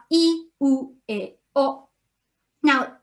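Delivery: normal talking voice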